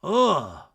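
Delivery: loud, brief groan of disgust; mock waking groan. Retelling a housemate waking up in the heat, mock groan. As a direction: groan out an imitated morning complaint. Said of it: "Jesse groans waking up".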